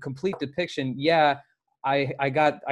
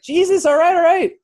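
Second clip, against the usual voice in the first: much higher, louder frightened pleading; a terrified driver yelling at a cop pointing a gun; panicked yell; 9 dB louder than usual. Retold or imitated. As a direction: beg him to calm down, terrified. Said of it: "And I'm like".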